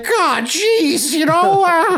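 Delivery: Morty-style stammer. Retold or imitated. Mocking Peterson crying, high Morty stammer. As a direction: stutter it out high and flustered. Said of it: "talks like Morty".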